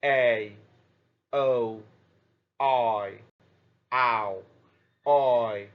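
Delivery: each of "A", "O", "I", "ow", "oi" said alone, drawn out and clear; teacher modelling diphthong sounds; sounding out diphthongs. Shown as a demonstration. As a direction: model each glide slowly for the class. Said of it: "and they are".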